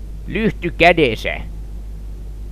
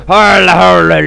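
loud garbled imitation of chatter; mocking their speech style; 8 dB louder than usual. Player mocking game characters' way of talking, loud gibberish. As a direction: mimic their talk as slurred nonsense. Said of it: "noitte vittu puhumistyylin kanssa".

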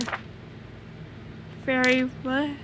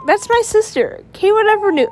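excited; 8 semitones higher, 8 dB louder than usual. Real, not acted. Introducing sister in game.

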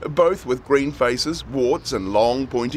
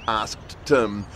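usual speaking voice

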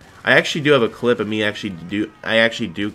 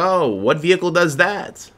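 hammed-up ad question; 6 semitones higher than usual. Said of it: "You're like".

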